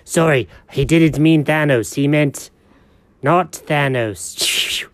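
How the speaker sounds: ordinary delivery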